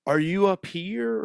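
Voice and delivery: "up here" drawn out high in place of "high"; imitating drunk garble. Drunk stranger questioning him, imitated garbled "up here". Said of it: "the way he put it".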